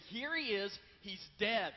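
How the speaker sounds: loud, proud proclaiming; triumphant announcement. Pretend. Skeptics showing off the body to the crowd, loud and proud. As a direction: declare it to the whole crowd.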